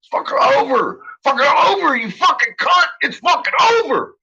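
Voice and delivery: high-pitched screaming fury. Retold or imitated. Yelling at the senator's sister on the phone, high furious scream. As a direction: scream it at her, high and cutting. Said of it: "I'm screaming".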